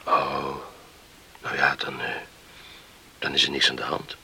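whisper. Acted. Talking in bed at night.

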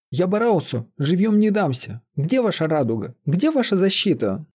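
high-pitched acted protest; heated challenge. A narrator's reading. Лиза demands protection from the sorcerer, heated challenge. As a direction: demand answers with lifted pitch.

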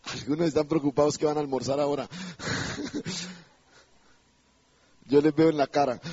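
low aside breaking into laughter; amused teasing. Real, not acted. Preacher teasing hungry congregation before lunch, amused teasing. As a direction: tease them with a chuckle.

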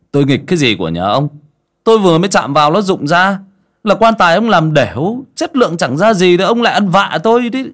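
loud, high, rapid-fire retort; indignant scolding. A narrator's reading. Cậu Tẩy blames the coffin maker's shoddy work, indignant scolding. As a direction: snap back, throwing the blame onto him. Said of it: "Bị cậu Tẩy nói như mắng".

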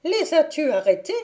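enraged fairy crying out at her servant; angry cry. Acted. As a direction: snap at the squire. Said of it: "s'écrie la fée".

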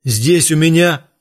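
high, loud urgent shout. A narrator's reading. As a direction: yell it in panic. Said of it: "прокричал Боул".

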